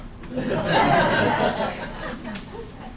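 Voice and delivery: breathy laugh rising in pitch; a real laugh at the joke. Real, not acted. Bandleader laughing at Chuck's joke, a breathy rising laugh.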